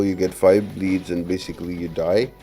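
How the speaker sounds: ordinary talk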